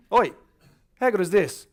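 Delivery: put-on loud, high, broad Australian accent; brash broad-Australian shout; exaggerated Aussie accent. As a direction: ham up your own Aussie accent. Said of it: "begging me to do this Aussie accent".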